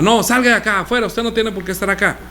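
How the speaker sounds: high, sharp pretend order to get out; mock throwing out. Pastor throws out daughter's friend. (orden fingida y aguda de echar a alguien)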